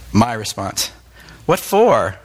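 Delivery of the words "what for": curt, mock-rude snap, louder and higher; a retold blunt what-for, pitched up; rude retort. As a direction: imitate your own rude reply.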